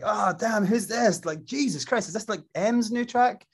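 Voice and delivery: mock wowed reaction; retold excitement. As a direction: imitate friends hyped by the new track. Friends react to fake track.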